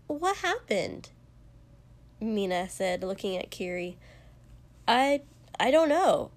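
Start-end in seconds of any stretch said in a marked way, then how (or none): 0.1-1.1 high, drawn-out puzzlement; baffled and pitched up; startled question. A narrator's reading. Startled question after sudden exit. 4.9-6.3 high, louder stammer; flustered, at a loss. A narrator's reading. Stammered reply to startled question.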